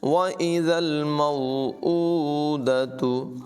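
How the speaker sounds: slow chanted tajweed recitation; Quran recitation. Shown as a demonstration. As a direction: recite it melodically, drawing out the vowels. (धीमी, सुरीली क़ुरान तिलावत)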